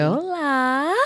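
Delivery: a light put-on Spanish accent on Hola; mock Spanish accent. Host teases guest with her Spanish accent, playful. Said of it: "you did not want me to do my accent".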